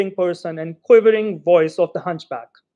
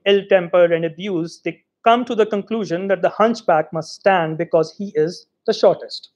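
ordinary delivery, nothing marked

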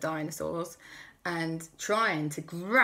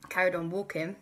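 ordinary delivery

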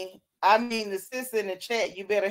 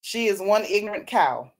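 ordinary talk

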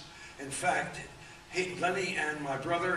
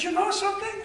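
much higher, louder imitated voice; 8 dB louder, faster than usual. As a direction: play the little man calling, pitched way up. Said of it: "He said".

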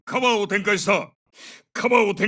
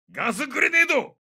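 high, sharp alarm callout; urgent warning; 7 semitones higher than usual. Acted. Soldier alerts squad to a gas grenade, urgent. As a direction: warn the squad at once.

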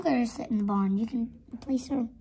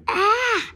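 high, loud acted scream; 10 semitones higher, 10 dB louder than usual. Horse cries out in the barn, loud high scream. Acted cry after confrontation.

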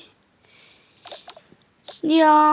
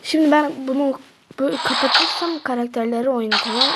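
normal talking voice

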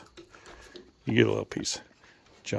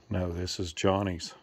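ordinary delivery

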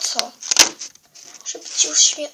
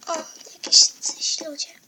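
eerie whisper; 2 semitones higher than usual. Acted. Lost girl calling in the dark.

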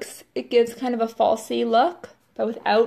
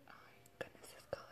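breathless whisper of awe; awed whisper; 31 dB quieter, much faster than usual. Real, not acted. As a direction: whisper it like a secret treasure. Awed by the lipstick color.

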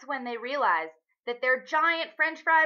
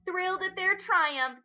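ordinary delivery

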